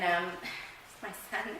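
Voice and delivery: ordinary talk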